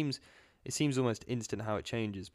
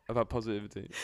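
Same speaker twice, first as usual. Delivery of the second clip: normal talking voice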